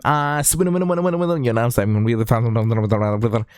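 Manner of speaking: exaggerated self-mocking garbled babble; mock tongue-tied mumbling; lispy fast gabble. Shown as a demonstration. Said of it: "so lispy".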